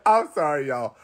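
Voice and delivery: high squealing laugh, then apology; laughing till crying. Real, not acted. Apologising to viewers after a high laughing fit. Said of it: "I'm laughing from crying".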